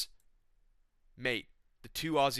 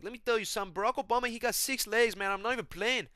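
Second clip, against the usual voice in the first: Renato Moicano; 9 semitones higher, 4 dB louder than usual. Acted.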